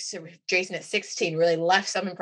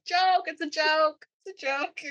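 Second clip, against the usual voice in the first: high, drawn-out calling out; mock prank reveal; 9 semitones higher, slower than usual. Acting prank reveal to panicked groom.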